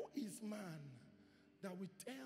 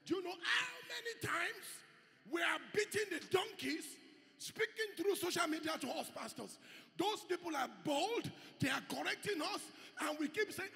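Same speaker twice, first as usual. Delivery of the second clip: high, loud preacher shout; full-force preaching; 9 semitones higher, 5 dB louder than usual. Acted. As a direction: drive it home to the pastors.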